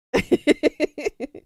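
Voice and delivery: bursting out in loud laughter. Real, not acted. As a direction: cackle loudly at the joke. Reacting to chat message.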